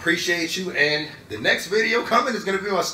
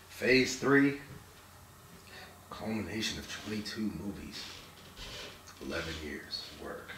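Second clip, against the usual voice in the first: hushed ending; 11 semitones lower, 11 dB quieter than usual. Real, not acted. Somber wrap-up of the saga.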